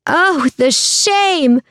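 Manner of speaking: very high, long drawn-out wail; crowd voice yelling. A narrator's reading. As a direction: wail from the crowd without real volume. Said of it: "another voice yelled from somewhere within".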